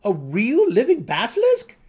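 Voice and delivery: wide-eyed gnome in disbelief; incredulous gnome. A narrator's reading. Young gnome asks the dark elf in disbelief, high and loud.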